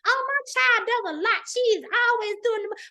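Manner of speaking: high-pitched doting mother; imitating mama. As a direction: mimic a mother marvelling at her child. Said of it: "My mama still said it".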